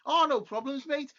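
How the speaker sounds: high, loud cheerful Scouser; mock Liverpool lad agreeing eagerly; hammed-up Scouse reply; Scouse accent. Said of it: "It was like".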